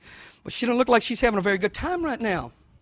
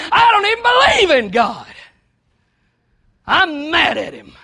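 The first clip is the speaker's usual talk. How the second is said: loud, higher, defiant voice of the cashier; angry cashier; 7 semitones higher, 7 dB louder than usual. Acted. Cashier breaking into the born-again talk, loud and bitter. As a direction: throw it back at them, bitter and loud.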